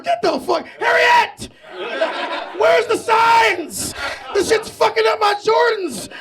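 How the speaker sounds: hammed-up panicked shouts; panicked calling out. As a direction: shout into the dark, lost and scared. Acting lost runaway slave in marsh.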